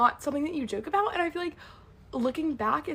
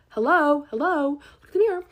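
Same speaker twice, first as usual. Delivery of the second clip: louder, higher mock-indignant hello; incredulous callout; 4 semitones higher, 8 dB louder than usual. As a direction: call her out in mock disbelief. Mock outburst at roommate's hypocrisy.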